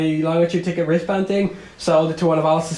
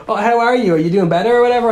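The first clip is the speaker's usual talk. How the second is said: worried caller; 6 semitones higher, 4 dB louder than usual. Retold or imitated. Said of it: "he was like".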